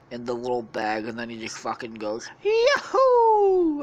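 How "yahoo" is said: very high, loud falsetto whoop; shrill, long, gleeful cry; Mario. Acted. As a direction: whoop it like a video game hero leaping. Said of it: "turns into fucking Mario".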